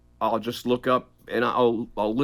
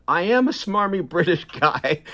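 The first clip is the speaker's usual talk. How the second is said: smarmy British; 6 semitones higher than usual. Retold or imitated. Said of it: "a smarmy British voice".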